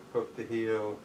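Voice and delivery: ordinary delivery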